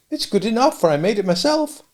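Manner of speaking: put-on high kindly old dame voice; a cottage dame fussing over a boy. Old dame offers the sooty boy milk, high and kindly.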